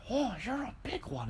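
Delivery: high, soft impression of an old lady. Tiny customer greets the big delivery man, imitated high and soft. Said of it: "the lady's like".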